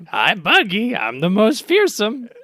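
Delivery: high, stretched-out cartoon boast; Buggy. Buggy presents himself on the broadcast screen, imitated showy boast. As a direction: imitate a showman announcing himself, slowly.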